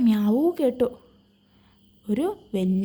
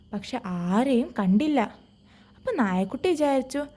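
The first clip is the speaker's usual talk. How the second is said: ordinary delivery, nothing marked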